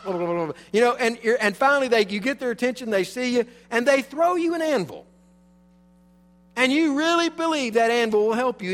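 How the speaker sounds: rising pulpit fervor; fervent preaching. Acted. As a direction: drive it home to the congregation. Sermon builds to fervor.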